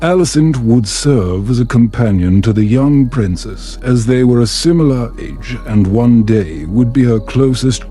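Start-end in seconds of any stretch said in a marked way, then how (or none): none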